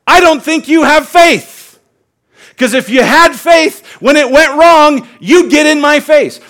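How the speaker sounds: loud, high, angry confrontation; indignant shout. Acted. God confronting Israel over their silence, loud indignant shout. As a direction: challenge them like an offended God.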